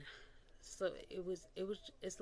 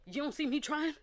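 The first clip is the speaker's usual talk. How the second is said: higher and louder, exasperated; annoyed outburst; 10 semitones higher, 10 dB louder than usual. Real, not acted. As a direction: let the irritation burst out. Annoyed at commenters' little remarks.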